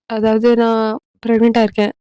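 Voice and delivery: plain reading voice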